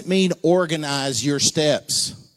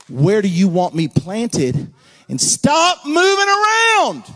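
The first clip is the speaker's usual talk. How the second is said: loud, high preacher shout; 6 semitones higher, 5 dB louder, slower than usual. Pretend. Preacher shouting at his congregation, loud and high. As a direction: belt it out from the pulpit.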